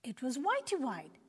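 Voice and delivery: ordinary delivery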